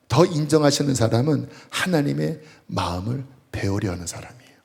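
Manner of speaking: normal talking voice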